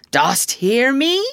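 high, loud, slow rising call; quivering cry. A narrator's reading. Hook calls to an unseen spirit, crying out. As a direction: call into the dark, lifting the end. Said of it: "he cried".

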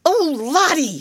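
high, pleading cry; distressed scream. A narrator's reading. Flustered teacher screaming at a crying child. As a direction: shriek it in a flustered panic. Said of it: "screamed Miss Amelia".

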